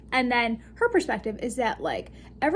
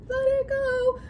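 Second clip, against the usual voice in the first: pitched-up singsong; high Elsa quote; 12 semitones higher than usual. Pretend. Joking Elsa line pitched high during the trance. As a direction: tease it like Elsa. Frozen reference again.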